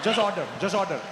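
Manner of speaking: contained, urging Indian English; Indian accent. Acted. Urging Steve to order, restrained Indian accent. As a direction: press him politely, keeping the Indian accent. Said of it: "You got a bit of an accent".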